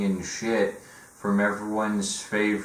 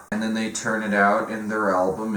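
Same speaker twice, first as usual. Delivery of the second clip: normal talking voice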